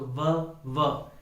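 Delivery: व said slowly and clearly. Shown as a demonstration. Teacher models व slowly for students. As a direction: stretch out व. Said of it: "स्पष्ट रूप से सुनाई देना चाहिए".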